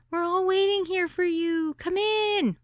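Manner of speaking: put-on high, breathless little-girl voice; breathless young girl. A narrator's reading. Hostess greeting the guest at the door, high and breathless. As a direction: say the name in one high breathless rush.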